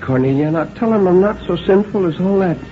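put-on high-pitched old woman's protest; indignant grandmother. A narrator's reading. Granny telling Cornelia she is not so sinful, indignantly.

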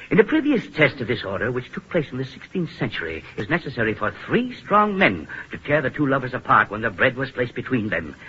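normal talking voice

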